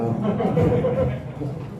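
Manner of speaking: breathy real laugh, voice high. Real, not acted. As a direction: laugh aloud at your own story. Laughs at own story. (자기 이야기에 터지는 웃음)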